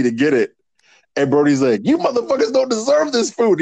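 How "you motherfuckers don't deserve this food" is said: high mock rant. Retold Brody Lee rant.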